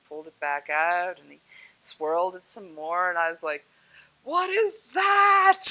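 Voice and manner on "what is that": high, loud, drawn-out astonishment; wowed, voice shooting way up; amazed outburst. Retold or imitated. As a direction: cry out in wonder, stretching the words. Acting out her amazed reaction.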